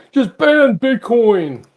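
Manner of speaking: high, loud, drawn-out mock outcry; mocking quote. Host mimicking crypto critics to his co-host, mocking shout. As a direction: slowly holler it as a parody of naysayers. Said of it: "I love when they go like".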